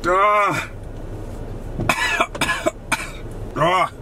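pained groan and cough; brain freeze. Real, not acted. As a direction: wince and cough through the freeze. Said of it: "got it again".

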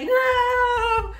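put-on high, loud childish shriek of refusal; imitating a child's shrill scream of no; mimicked child scream. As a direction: shriek it like a toddler digging in.

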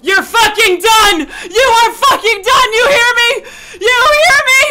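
loud, high, mounting screams; furious yelling. Real, not acted. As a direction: scream threats at the enemy. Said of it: "just fucking yelling".

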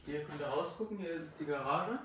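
ordinary delivery

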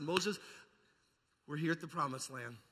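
ordinary talk

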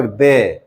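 "দে" with an e vowel instead of ee; teacher's demonstration of "দে". Shown as a demonstration. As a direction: model a slow "দে" for before consonants. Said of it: "এইখানে বলতে হবে".

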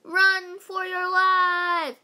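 high, very loud, drawn-out alarm shout. A narrator's reading. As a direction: yell a slow, stretched warning. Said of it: "shouted Ryan".

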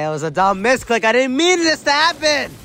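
rising to a high, loud wail; whiny, climbing outburst of frustration; distressed protest. Real, not acted. Protesting his accidental pick.

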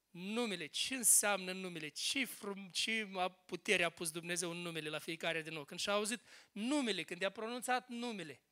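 hushed wonder. Real, not acted. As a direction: drop to a quiet, reflective murmur. Marvels at power of the name.

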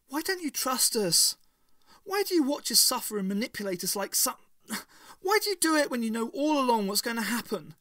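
high, loud, fast outburst; anguished outburst. A narrator's reading. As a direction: pour out her hurt at him. Ace pleads angrily.

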